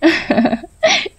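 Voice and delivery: high vain giggle; vain laugh. A narrator's reading. Rupa laughs at the sadhu's threat, vain giggle. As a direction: laugh it off proudly.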